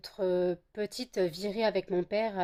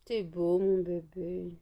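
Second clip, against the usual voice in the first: soft, tender lulling to a pet; soft cooing; 7 dB quieter than usual. Real, not acted. Owner lulling her dog to sleep, soft tender coo.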